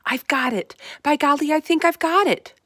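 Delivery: loud, high burst of excitement; excited. A narrator's reading. Sophie suddenly finding the answer, excited loud cry. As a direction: shout it as the idea hits. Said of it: "in a voice filled with excitement".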